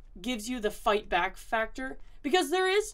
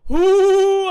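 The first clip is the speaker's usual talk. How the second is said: excited; 8 semitones higher, 15 dB louder than usual. Real, not acted. Triumphant exclamation.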